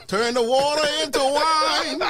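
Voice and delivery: high, slow, laughing preacher cry; mock preacher. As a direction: stretch each word like a preacher hamming it up.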